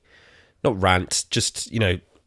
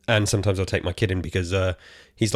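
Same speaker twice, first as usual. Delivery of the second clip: usual speaking voice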